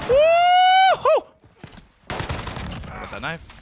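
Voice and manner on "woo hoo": very high, loud whoop; piercingly high excited cheer; celebratory whoop. Real, not acted. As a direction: let out a long victory woo-hoo. Celebrating in the game.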